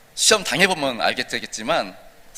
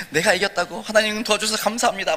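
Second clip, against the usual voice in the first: higher, thrilled cry of thanks; overjoyed; 8 semitones higher than usual. Acted. Young man thanks God after winning, overjoyed and higher. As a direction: burst out happily, thinking you've won. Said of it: "너무 신나했어요".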